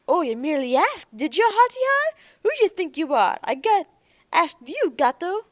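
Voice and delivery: loud, high, taunting mimicry; mocking sneer. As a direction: throw his words back at him with scorn. Thug mocks Derek's words.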